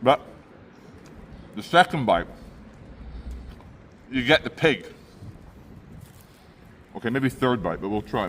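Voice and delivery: talking through a mouthful of pie; chewing while talking; mouth full. Real, not acted. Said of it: "I'll speak with my mouth full".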